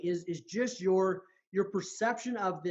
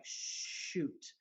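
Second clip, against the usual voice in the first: whispered realization; 9 dB quieter than usual. Retold or imitated. Sudden realization in story.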